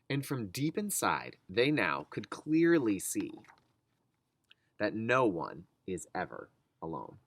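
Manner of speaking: put-on reading a picture book aloud to kids; gentle read-aloud pace for young listeners; story narration. Reads the book's ending.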